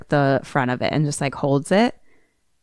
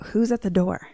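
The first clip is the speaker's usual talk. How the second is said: ordinary talk, nothing marked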